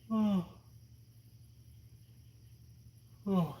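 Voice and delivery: big sleepy yawn. Real, not acted. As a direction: yawn through the word. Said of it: "even I'm falling asleep here".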